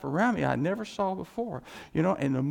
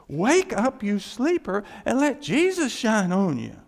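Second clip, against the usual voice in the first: loud, raised call to wake up; preaching call; 4 semitones higher, 6 dB louder than usual. Acted. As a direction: shout it to the sleeping church. Preaching the verse again.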